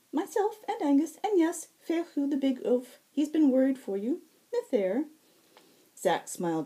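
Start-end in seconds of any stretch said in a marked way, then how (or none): 0.1-5.1 high, ringing, lilting fae voice; bright high herald. Acted. Fae herald greeting the wounded Zack, high and bright. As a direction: sing it out high and sweet.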